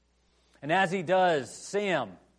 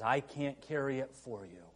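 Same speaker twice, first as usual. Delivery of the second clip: quiet tender resolve; 4 semitones lower, 7 dB quieter than usual. Retold or imitated. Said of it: "looks at him and says".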